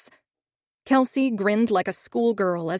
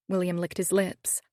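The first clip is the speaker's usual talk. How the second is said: usual speaking voice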